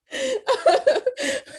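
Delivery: high amused giggling laugh; a light high giggle. Real, not acted. Teacher laughing after greeting the guest, high giggle.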